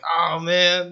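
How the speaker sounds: loud, high burst of mock dismay; mock exclamation. An adult reacting to the kid's answer with a loud, high mock exclamation. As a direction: blurt it out as a hammed-up groan. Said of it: "Be like".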